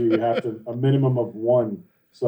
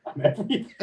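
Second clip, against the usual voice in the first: a giggly echo, pitched up and hushed; laughing, higher and softer; 4 semitones higher, 5 dB quieter than usual. Real, not acted. Mimicking a friend's boast, laughing high and soft. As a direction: laugh through the words, much higher.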